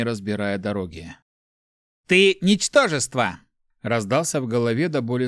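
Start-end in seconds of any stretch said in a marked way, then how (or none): 2.1-3.4 high, loud, slow contempt; drawn-out, theatrical scorn; scornful insult. A narrator's reading. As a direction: spit out the insult with weight. Inner voice insults Mart.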